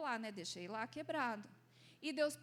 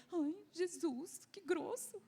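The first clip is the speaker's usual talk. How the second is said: higher, put-on whiny voice; mock-offended pout at Jesus; whiny complaint; 5 semitones higher, slower than usual. She whines to Jesus who broke her plate, hurt and offended.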